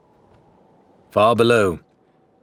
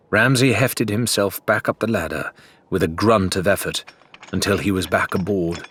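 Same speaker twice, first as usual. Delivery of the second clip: ordinary delivery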